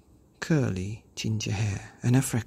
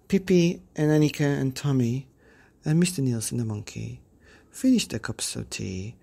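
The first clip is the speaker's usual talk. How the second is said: ordinary delivery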